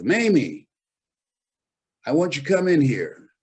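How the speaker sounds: high, loud summons from the lady of the house; bossy employer calling. Retold or imitated. Wealthy employer calling the maid in. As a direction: call the maid in like a demanding employer.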